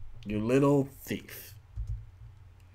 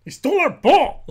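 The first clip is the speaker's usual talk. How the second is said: loud, high gym bro whine; 14 semitones higher, 11 dB louder than usual. Acted. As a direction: shout it like an offended frat guy. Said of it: "I'm trying to say it in a bro, a bro, uh, bro voice too".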